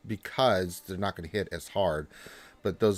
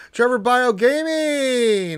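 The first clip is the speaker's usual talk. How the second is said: much higher, louder, slow drawn-out name call; hyped drawn-out name shout; announcer call; 14 semitones higher, 9 dB louder, much slower than usual. Pretend. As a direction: boom it out like a ring announcer.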